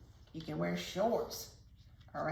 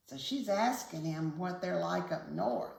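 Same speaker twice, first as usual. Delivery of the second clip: plain reading voice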